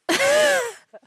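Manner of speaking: a sudden, high burst of laughter; loud laugh. Real, not acted. Laughs back on stream.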